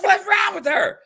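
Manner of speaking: high-pitched mock outrage; retold indignant complaint. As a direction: squawk it in mock disbelief. Retold complaint about Angela.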